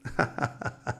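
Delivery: soft, low chuckle; laugh. Real, not acted. Preacher amused after quoting the Lord, soft chuckle. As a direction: chuckle quietly to yourself.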